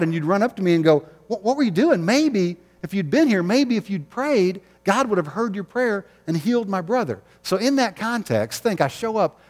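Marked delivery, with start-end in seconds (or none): 1.3-7.2 higher, pleading accusation; a hint of a grieving sister. Grieving sister confronts late Jesus.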